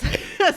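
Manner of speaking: sudden high loud laugh; real giggle, high and loud; high burst of laughter. Real, not acted.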